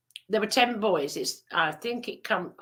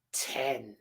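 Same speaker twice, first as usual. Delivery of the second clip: much lower, much softer; hushed realisation; 7 semitones lower, 9 dB quieter than usual. Retold or imitated. Boy discovering he counts too, hushed. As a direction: breathe it out in wonder.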